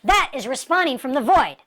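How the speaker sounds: ordinary delivery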